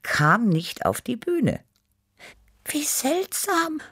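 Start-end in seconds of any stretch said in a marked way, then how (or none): 2.6-3.8 slow, high breathy whisper; breathed. A narrator's reading. Small cat marvels at the missing band, breathing it. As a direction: whisper it in tiny-voiced wonder. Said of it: "hauchte Tippi".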